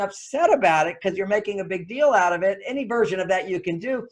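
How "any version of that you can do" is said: usual speaking voice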